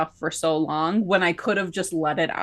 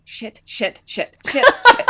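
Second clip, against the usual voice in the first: swearing in rising panic, from muttered to a loud high yelp; inner panic building; 7 semitones higher, 8 dB louder, slower than usual. Retold or imitated. Inwardly panicking at being paired with him, rising to a high yelp. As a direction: let the swearing climb into a panicked squeak. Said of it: "inside I was going".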